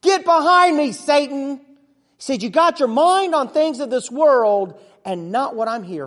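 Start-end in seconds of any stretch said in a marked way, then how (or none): 0.0-1.6 high, loud shout, behind hammered; yelled rebuke. Retold or imitated. Jesus yells at the disciple, a loud high rebuke. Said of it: "He yelled at me". 2.4-6.1 loud, raised scolding shout; yelled rebuke. Retold or imitated. Jesus still yelling at the disciple, loud scolding. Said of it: "He yelled at me".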